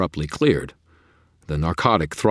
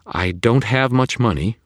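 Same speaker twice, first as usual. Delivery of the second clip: put-on louder, higher worried young woman; anxious Susan; 8 semitones higher, 8 dB louder than usual. A narrator's reading. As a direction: blurt out nervously. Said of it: "Susan began".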